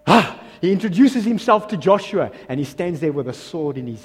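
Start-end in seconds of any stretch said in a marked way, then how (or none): none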